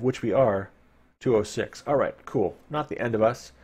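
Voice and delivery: ordinary talk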